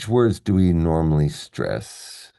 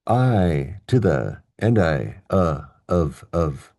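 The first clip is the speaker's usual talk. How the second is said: disconnected small words with gaps; slower than usual. Shown as a demonstration. Teacher demonstrates meaningless words, said word by word. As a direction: list each little word separately, deliberately.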